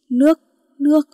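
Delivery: feverish plea. Acted. Feverish man begging for water.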